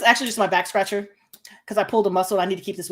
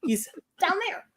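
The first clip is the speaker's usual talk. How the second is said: squeaky teasing He's down there; playful high-pitched second take; higher, softer repeat of He's down there; 10 semitones higher, 5 dB quieter than usual. Retold or imitated. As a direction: lift it high and hush it.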